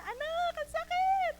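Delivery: put-on very high, loud cry of an elderly mother in pain; imitating mother calling her child in agony. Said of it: "Ganun siya".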